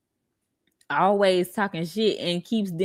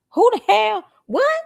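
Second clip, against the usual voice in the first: higher and louder, stunned; outraged disbelief; 14 semitones higher, 6 dB louder than usual. Real, not acted. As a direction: yelp it in shock.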